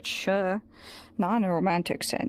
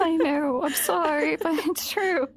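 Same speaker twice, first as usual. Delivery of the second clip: tearful; 7 semitones higher, 4 dB louder than usual. Real, not acted. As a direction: voice higher and tight with real tears. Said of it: "I said you were gonna make me cry with this".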